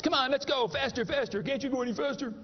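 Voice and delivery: put-on egging on the ride like a thrilled kid; kids screaming on ride. Preacher voicing thrilled kids on a merry-go-round, high mock cheer. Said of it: "They're screaming at the football players".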